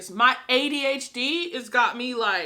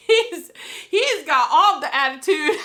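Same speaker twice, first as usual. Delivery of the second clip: laughing; 3 semitones higher, 4 dB louder than usual. Real, not acted. Laughing about snarky dragon.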